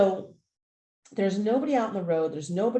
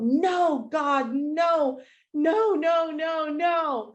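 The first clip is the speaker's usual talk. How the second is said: high, louder desperate screams of no; screaming no; 8 semitones higher, 3 dB louder, much slower than usual. Retold or imitated. Owner seeing her dog on the bridge, screaming high. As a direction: cry out in terror as the dog runs. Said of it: "And I just scream out".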